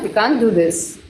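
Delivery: ordinary talk, nothing marked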